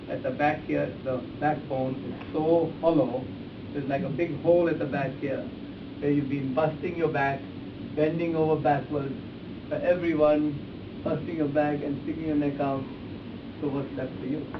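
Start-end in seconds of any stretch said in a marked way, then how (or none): none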